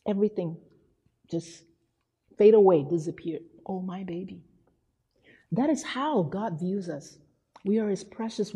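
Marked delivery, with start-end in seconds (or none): 3.7-4.4 very soft, hushed motherly murmur; softly adoring; tender cooing. Retold or imitated. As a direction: imitate a mother melting over her newborn. Voicing new mother cooing at baby.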